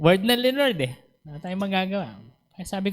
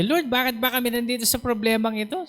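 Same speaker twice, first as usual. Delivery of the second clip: higher, quick questioning plea; mock complaint; 8 semitones higher, faster than usual. Preacher retells asking the Lord about the problem, complaining. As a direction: put it to God as a mild complaint.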